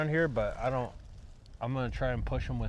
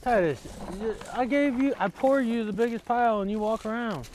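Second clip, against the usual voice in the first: exasperated; 8 semitones higher, 3 dB louder than usual. Real, not acted. Scolding the animal for not eating.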